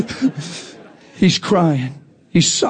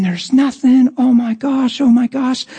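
higher voice, lightly acted weeping wonder; imitated tearful amazement; 7 semitones higher than usual. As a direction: mimic her tearful disbelief. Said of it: "She's crying. She's saying".

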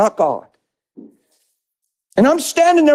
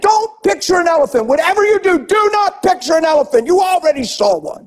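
emphatic demo; 8 semitones higher than usual. Shown as a demonstration. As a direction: hammer do not picture an elephant. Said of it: "if I said".

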